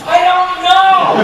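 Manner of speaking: high, loud, drawn-out wail; exasperated mock yell. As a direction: stretch the protest out at a shout. Acting out the accused person's reply.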